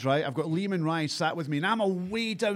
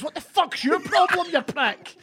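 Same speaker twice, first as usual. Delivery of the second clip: high-pitched indignant outburst; acted angry challenge; 9 semitones higher than usual. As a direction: re-enact squaring up to a stranger. Retold confrontation with man in cinema.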